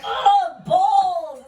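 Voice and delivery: high, loud yelp of pain; mock pained yelp. Man grabbed by the balls, high pained yelp. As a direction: yelp like someone got grabbed. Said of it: "It's like".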